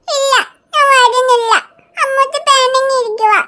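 put-on small weepy child voice, drawn out; tearful little girl. Little Riya whimpering to her aunt about school. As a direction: whine it like a toddler near tears.

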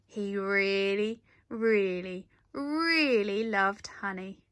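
slow, stressed repeated really; drawn-out really. A narrator's reading. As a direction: linger on each really to show how much. Emphatic narration.

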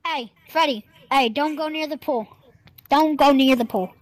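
loud, drawn-out parental warning; calling out a warning. Pretend. Parent warning Freddy away from the pool, loud and firm. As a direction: call out slowly and loudly to a child.

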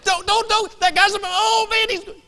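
high, loud, frantic mock warning; acted panicked holler; hollering at the screen. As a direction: yell like an over-invested movie watcher. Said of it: "I'll be hollering at the guy".

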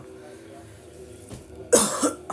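short real coughing bout; sudden coughs between remarks. Real, not acted. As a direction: cough from a throat tickle. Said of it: "random tickle in my throat".

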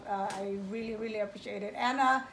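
soft, tearful thanks; crying, worn out. Real, not acted. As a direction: thank them while holding back tears. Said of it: "I can't stop crying".

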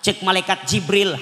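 ordinary talk, nothing marked

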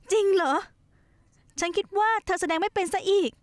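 surprised. Acted. Surprised she can act.

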